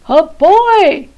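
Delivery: loud booming bear yell; a giant bear yelling in fright at a boy. Acted. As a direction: bellow it out in shock. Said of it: "yelled the bear".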